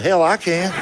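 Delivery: ordinary talk, nothing marked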